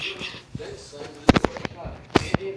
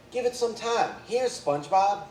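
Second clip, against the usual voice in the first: Sandy voice; 7 semitones higher, 4 dB louder than usual. A narrator's reading. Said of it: "She gave SpongeBob a Krabby Patty".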